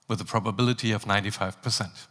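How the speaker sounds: casual captain slipping it in, soft and fast; pilot announcement. Acted. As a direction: breeze through the number like routine. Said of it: "listen to my voice coming over the speakers".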